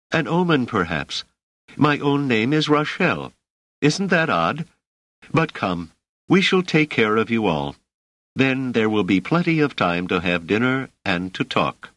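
be gracious and take charge of the guests; genial motherly hostess. A narrator's reading. Hostess introduces herself and takes them in, genial. Woman's character voice.

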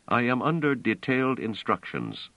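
plain reading voice

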